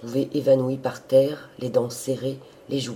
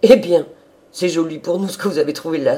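loud, higher-pitched servant's cry; amused exclamation; 5 semitones higher, 9 dB louder than usual. A narrator's reading. Old servant reacting to the sister's remark, exclaiming loudly. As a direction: burst out in mock offence. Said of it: "s'écria la Teuse en riant".

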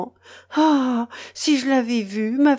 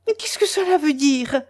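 higher-pitched indignant question; raised, astonished exclamation; exclaiming; 6 semitones higher than usual. A narrator's reading. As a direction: cry out in protest. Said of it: "s'écria Mélanie".

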